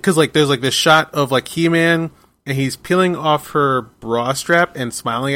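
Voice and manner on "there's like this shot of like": usual speaking voice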